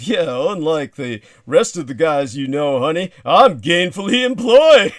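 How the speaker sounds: high, loud, smug boast; mock brag. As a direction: boast loudly to the lady. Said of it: "make the jokes along the lines of".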